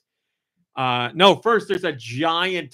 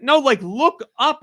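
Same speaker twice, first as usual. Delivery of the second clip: high, louder, drawn-out insistence; slow it down and push each word; exasperated correction; 11 semitones higher, 5 dB louder than usual. Retold or imitated. Daughter correcting dad's tiny head tilt, exasperated.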